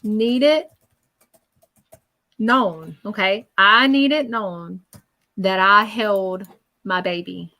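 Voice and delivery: slow, deliberate, each word weighed; solemn spirit quote. Retold or imitated. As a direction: insist it be known. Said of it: "He says".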